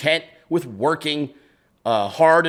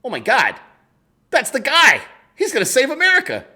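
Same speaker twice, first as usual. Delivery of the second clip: higher and louder, mock thrilled discovery; retold giddy excitement, much higher; excited; 10 semitones higher, 4 dB louder than usual. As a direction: gush like you just found a hero. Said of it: "and I was like".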